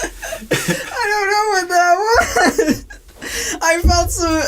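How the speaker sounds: high, slow wheeze of real laughter; words dragged out through laughing tears; helpless laughter. Real, not acted. Joke teller baffled by own improvised joke, helpless high laughter. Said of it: "I'm dying".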